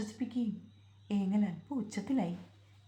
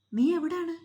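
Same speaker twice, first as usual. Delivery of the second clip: higher, louder searching shout; an anxious husband's call across the yard; 8 semitones higher, 5 dB louder than usual. Acted. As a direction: shout to find her somewhere nearby.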